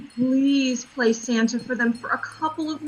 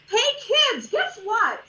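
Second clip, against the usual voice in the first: excited call; 10 semitones higher than usual. Acted. Calling the children over.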